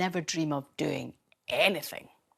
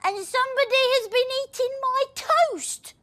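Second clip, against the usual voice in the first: put-on child voice; 5 dB louder than usual. Little person voiced.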